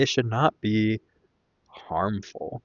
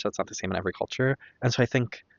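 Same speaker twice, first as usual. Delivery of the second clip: usual speaking voice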